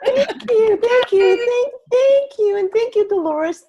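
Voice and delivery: delighted laughing gratitude; laughing thanks. Real, not acted. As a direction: gush thanks through real laughter. Thanked after praise, laughing.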